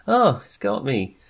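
usual speaking voice

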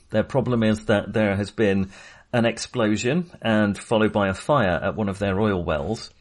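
usual speaking voice

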